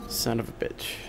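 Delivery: unvoiced muttered curse; whispered curse. Real, not acted. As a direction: whisper the curse under breath. Muttered curse after mistake.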